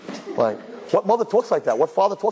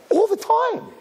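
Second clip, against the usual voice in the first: very high, emphatic ALL THE TIME; shrieked admission; slower than usual. Acted. Parent admitting she tells daughter, high emphatic ALL THE TIME. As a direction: squeal ALL THE TIME with zero shame.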